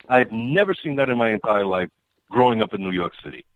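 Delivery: ordinary talk, nothing marked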